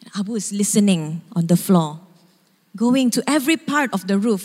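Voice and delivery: ordinary delivery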